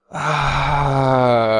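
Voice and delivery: usual speaking voice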